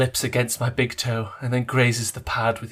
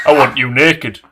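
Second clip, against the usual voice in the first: louder hammed-up male murmur; mock murmur; 9 dB louder than usual. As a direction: play the leading man murmuring. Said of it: "he murmurs".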